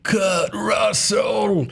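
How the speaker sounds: slow, high, drawn-out deathbed cry; dramatic dying gasp. Pretend. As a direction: gasp the name out like a dying man. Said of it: "He wasn't on his deathbed going".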